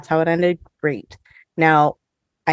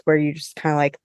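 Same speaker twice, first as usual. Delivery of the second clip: ordinary delivery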